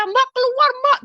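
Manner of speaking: very high, loud call to get out; frantic shout to an older sister; urgent yell. Retold or imitated. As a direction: act out a panicked sibling's cry. Brother urgently pulling sister outside.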